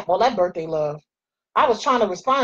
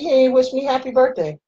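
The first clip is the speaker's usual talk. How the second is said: retold complaint; 5 semitones higher, 3 dB louder than usual. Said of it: "I said".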